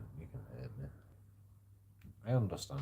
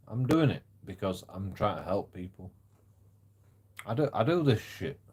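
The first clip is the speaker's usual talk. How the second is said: ordinary delivery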